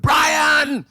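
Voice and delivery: high, loud urgent shout of a name; father crying out for his son from the next room; desperate call. Retold or imitated. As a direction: call out to your son as if something is badly wrong. Father calling son in distress.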